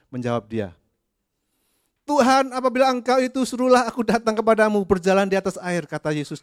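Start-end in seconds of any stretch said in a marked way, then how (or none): 2.1-5.9 higher, louder desperate call; Peter calling out. Acted. Peter calls out to Jesus from the boat, high and loud. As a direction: call across the water to the Lord.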